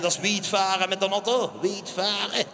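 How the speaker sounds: a light stab at a Limburg accent. Shown as a demonstration. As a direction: try a Limburg accent, half-hearted. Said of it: "Mijn poging tot een Limburgs accent".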